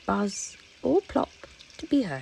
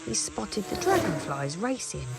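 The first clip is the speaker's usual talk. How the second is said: plain reading voice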